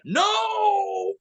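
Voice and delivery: high, drawn-out wail of protest; long high-pitched mock scream; mock anguished cry. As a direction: cry out in hammed-up despair. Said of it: "And he's like".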